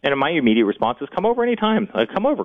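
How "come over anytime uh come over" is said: pitched high, quick-fire invitation; retold open invitation. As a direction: quote yourself throwing the doors open, voice up high.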